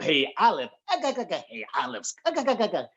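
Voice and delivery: high gargling Popeye chuckle, ga ga ga; Popeye impression. Comic mimicking Popeye's call to Olive, high gargled laugh. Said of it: "like Popeye the Sailor".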